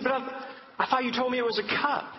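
high, halting retold bafflement; feigned confusion. Father questions daughter about the cup, mock confusion. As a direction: play the puzzled dad making a point. Father plays puzzled to make point.

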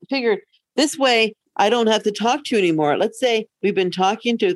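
usual speaking voice

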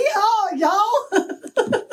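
high hammed-up southern cowboy yell; mock southern drawl. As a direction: play a cartoon Texan for laughs. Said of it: "getting to the sound of being like".

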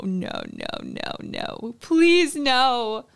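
cringe inwardly, begging it not to be true; horrified pleading. Retold or imitated. Realising another student's real name is Shay, mortified pleading.

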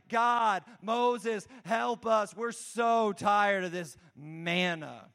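hammed-up fed-up plea; mock complaining. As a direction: complain wearily, dragging out the last words. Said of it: "they start complaining".

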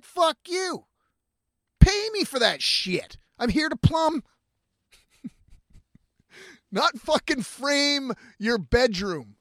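a hammed-up angry tradesman; plumber's mock rant. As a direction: play the offended plumber telling him off. Plumber refusing extra work.

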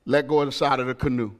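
low, soft, steady reassurance; calm voice. Retold or imitated. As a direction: coax someone gently out of panic. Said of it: "swam up in a calm voice".

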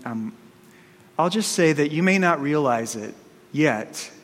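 usual speaking voice

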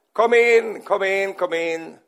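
loud call fading down; cheerful call from the bath. Retold or imitated. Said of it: "Då ropar han". (Churchill ropar glatt från badet)

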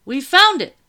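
high, very loud call; excited shout. A narrator's reading. Soldier reporting a find from upstairs, shouting. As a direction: shout the discovery down the stairs. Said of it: "shouted a soldier upstairs".

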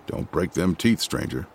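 low, soft menacing warning; ominous. A narrator's reading. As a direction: lean in and threaten quietly. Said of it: "saying ominously".

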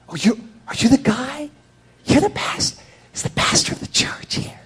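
high, hushed, breaking into a whisper; star-struck churchgoer, hammed-up; awed admirer. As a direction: whisper it in mock awe. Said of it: "people look at you like".

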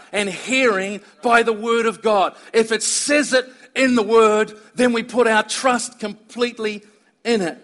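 preaching. Acted. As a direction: press the point home to the congregation. Preaching builds in force.

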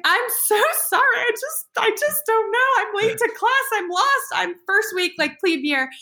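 high, pleading mock-tearful babble; imitating tearful apology. Late plebe apologizing to officer's wife, mock tearful. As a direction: plead in a high, hammed-up near-cry. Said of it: "I was, like, in tears".

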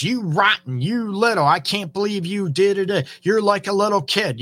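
mocking insult. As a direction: play the belittling partner sneering at a kid.

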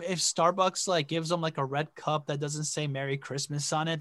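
normal talking voice

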